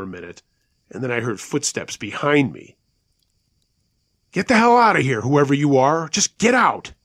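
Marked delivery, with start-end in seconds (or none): 4.3-6.9 loud, high-pitched angry order; yelling. A narrator's reading. Jimmy's dad facing the huge thing by the tents, yelling. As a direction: yell to drive the intruder away. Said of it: "Jimmy's dad was yelling at the thing".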